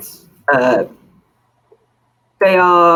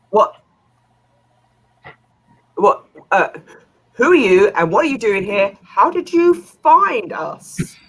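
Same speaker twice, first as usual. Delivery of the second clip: startled merchant; 4 semitones higher, 5 dB louder than usual. Acted. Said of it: "the merchant looks at you like".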